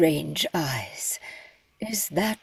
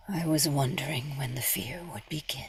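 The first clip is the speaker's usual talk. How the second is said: low, fast, soft unvoiced whisper, grim; somber whisper; 3 semitones lower, 8 dB quieter, much faster than usual. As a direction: barely breathe it, full of foreboding. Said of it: "a bit of a somber tone here".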